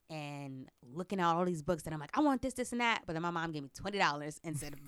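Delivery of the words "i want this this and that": put-on high, loud kid wanting everything; little kid pointing at every book; eager child. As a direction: beg like an excited kid at a book fair.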